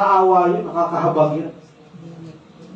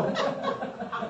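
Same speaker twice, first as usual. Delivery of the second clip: soft breathy laugh; 3 semitones higher, 13 dB quieter than usual. Real, not acted. Preacher laughs at own joke.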